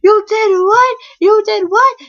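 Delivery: high, loud incredulous shout; shocked mom. Retold or imitated. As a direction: imitate a mother yelling in disbelief.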